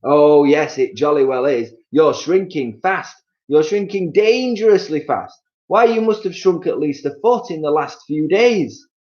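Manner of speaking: husband gleefully scaring his wife; Mister Twit gloating. Acted. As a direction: relish scaring her.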